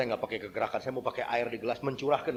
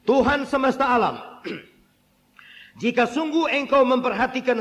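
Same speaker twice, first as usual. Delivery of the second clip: raised, projected vow to God; scripture prayer declamation; 9 semitones higher, 5 dB louder than usual. Acted. Hana vowing her son to God, declaimed prayer. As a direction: declaim it as a solemn plea.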